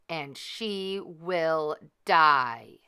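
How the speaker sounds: slow, drawn-out deadly curse. Acted. Wicked fairy cursing the baby, slowly and menacing. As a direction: let each word fall slowly.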